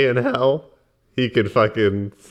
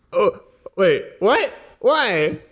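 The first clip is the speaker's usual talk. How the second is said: high, surprised questions; startled; 8 semitones higher, much slower than usual. Acted. Character startled as Berzin opens the door, high. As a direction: react in alarm to the opening door.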